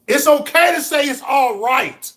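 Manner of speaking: high, loud exhortation; full-voiced rallying shout; preacher's shout. Real, not acted. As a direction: cry it out to the whole crowd. Building motivational refrain to a peak.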